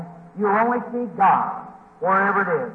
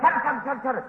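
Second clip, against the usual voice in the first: quick imitated squirrel noises; little animal's repeated scolding; squirrel chatter; 2 semitones higher, 5 dB quieter than usual. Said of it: "And he kept".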